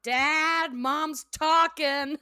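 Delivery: high, drawn-out call to Dad; imitated kid complaint. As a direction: stretch it out like a kid tattling. Voicing Bobby calling to his dad.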